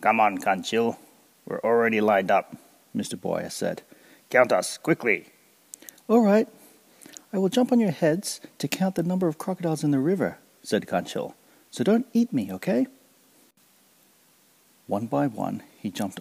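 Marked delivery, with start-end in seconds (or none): 0.0-5.3 louder, impatient crocodile voice. Acted. Mister Buaya urging Kancil to count quickly, louder and impatient. 6.1-12.9 much higher and louder, cheeky; chirpy and sly; Kancil voice. Acted. Kancil telling the crocodiles not to eat him, high and cheeky.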